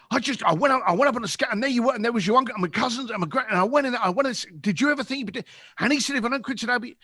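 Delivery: rushed excited babble. Retold or imitated. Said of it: "spouting like I'm on acid".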